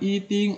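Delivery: "i ting" with "i" held level; wrong first-tone reading. Shown as a demonstration. Teacher rejecting this reading, "i ting" with level "i". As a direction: keep "i" even before "ting". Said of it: "bukan i ting".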